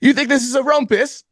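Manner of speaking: put-on high-pitched hammy English accent; fake English accent. Would-be robber challenging the clerk, fake English accent. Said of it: "a fucking English accent".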